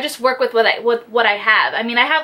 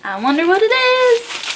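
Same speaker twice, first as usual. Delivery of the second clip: high, rising singsong; playful high-pitched curiosity; singsong wondering; 11 semitones higher than usual. Real, not acted. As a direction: wonder aloud, lilting way up high. Curious about next parcel.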